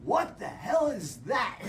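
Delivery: loud, high mock shout, slow; hammed-up startled yell; Ed jumping out of his car at the eagle, loud and slow; Ed's shock. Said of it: "and he goes".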